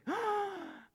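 big audible intake of breath; high, drawn-in gasp; exaggerated gasp. Shown as a demonstration. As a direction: gasp for air after a long list. Big breath after list of names.

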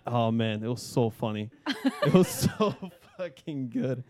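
ordinary talk

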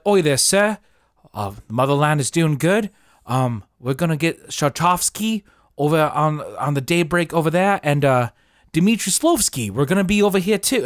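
Russian soldiers with mock British accents. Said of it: "have British accents".

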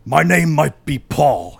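slow, halting cartoon villain; deep Klingon voice. A narrator's reading. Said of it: "with a deep voice that sounded a lot like a Klingon".

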